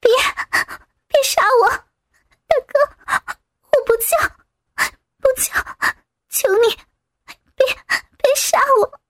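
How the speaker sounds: high, tearful pleading; pitiful begging. A narrator's reading. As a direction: beg for your life through tears. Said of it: "雨雪可怜巴巴地哀求".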